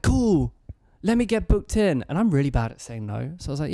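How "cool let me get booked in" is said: high, raised-voice mock holler; eager call across a distance; retold yelling. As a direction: imitate someone shouting up from the street. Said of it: "And yell out".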